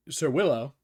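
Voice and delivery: first knock, calling politely but loud; calling through a door. Acted. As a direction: call out to someone inside. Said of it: "Knocks on the door and goes".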